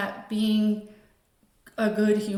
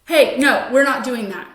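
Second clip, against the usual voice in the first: sharp, louder snap of no; quick punitive response; 9 dB louder than usual. Shown as a demonstration. Mentor snapping at a child mid-session, sharp punitive no. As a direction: shut the kid down fast and hard. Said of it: "quick response ... that was a little punitive".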